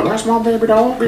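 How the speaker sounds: put-on high cooing baby talk. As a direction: coo it like talking to a puppy. Cooing to her dog.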